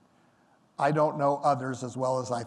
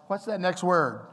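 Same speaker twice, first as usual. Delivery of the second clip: normal talking voice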